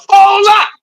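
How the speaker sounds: loud, high hold up; sudden shout. Real, not acted. Streamer halting his rant with a loud hold up. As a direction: cut in with a startled shout.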